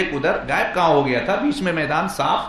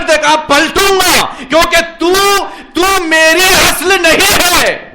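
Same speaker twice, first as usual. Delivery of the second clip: high, very loud sermon delivery; impassioned high-pitched shouted sermon; full-force preaching; 12 semitones higher, 12 dB louder than usual. Acted. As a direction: preach it at the top of your voice.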